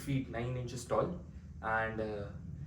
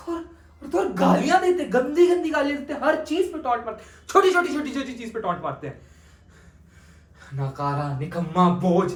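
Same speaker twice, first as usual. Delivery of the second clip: bitter complaints rising louder and higher; resentful outburst; 8 semitones higher, 6 dB louder than usual. Acted. Ved venting alone about being treated as a servant, bitter. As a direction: let the hurt turn into anger. Nephew's grievance grows heated.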